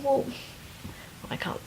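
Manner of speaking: normal talking voice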